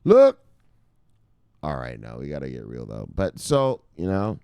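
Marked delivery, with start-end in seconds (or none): 0.0-0.4 loud call. Real, not acted. Calling attention loudly.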